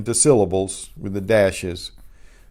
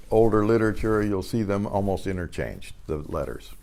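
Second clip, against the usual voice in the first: ordinary delivery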